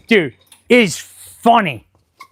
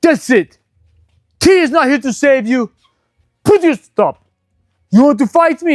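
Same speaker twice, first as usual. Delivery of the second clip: mock tough challenge; 5 semitones higher than usual. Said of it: "He's like".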